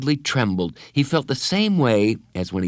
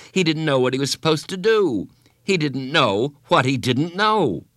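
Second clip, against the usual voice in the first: higher, stretched-out narration; mounting fluster; 6 semitones higher, 3 dB louder than usual. A narrator's reading. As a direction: build the boy's panic as you narrate. Narrator mirrors boy's mounting panic.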